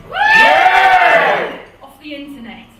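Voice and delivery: ordinary talk, nothing marked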